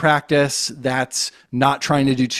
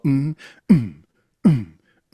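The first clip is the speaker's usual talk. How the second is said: low hummed mm kick; hummed kick drum. Shown as a demonstration. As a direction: hum a low comfortable kick. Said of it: "very comfortable low".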